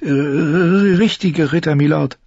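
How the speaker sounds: put-on high, slow, hesitant girl's reply; timid answer. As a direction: answer shyly, barely daring. Sansa antwortet ängstlich. (schüchtern und zögernd)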